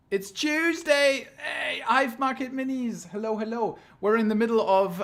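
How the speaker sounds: high, loud, hyped host voice; tabloid tv host. Pretend. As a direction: greet them like a gushing TV presenter. Over-the-top stream host greeting.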